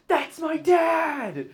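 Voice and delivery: high, slow drawn-out yell. Retold or imitated. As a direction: shout it out across the room. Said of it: "You yelled that".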